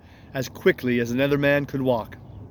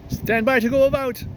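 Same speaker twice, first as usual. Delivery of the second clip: much higher, louder parrot screech; parrot scream; 10 semitones higher, 4 dB louder than usual. Acted. Parrot screaming ship's order again, much higher and louder. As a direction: squawk it shrilly again. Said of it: "the parrot would scream".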